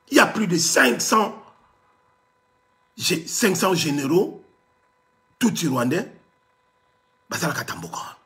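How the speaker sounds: ordinary delivery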